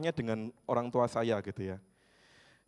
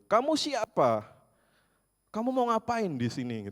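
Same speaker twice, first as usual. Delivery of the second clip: high, shrill-pitched mock yell of a grumpy grandma; imitating a cranky grandma shouting; cranky old woman yelling; 9 semitones higher than usual. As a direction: yell at the stranger at the gate like a fierce old lady. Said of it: "oma ini sudah teriak".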